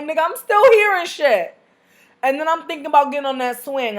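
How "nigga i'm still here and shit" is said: high, loud, slow taunt; high loud slow taunt called after a man leaving; mocking call out. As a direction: yell it after him teasingly. Calling after him, lying.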